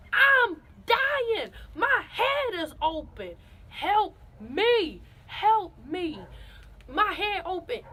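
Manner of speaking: dramatic pleading for help; mock pleading. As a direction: ham up begging for help. Retelling pleading in hospital lobby.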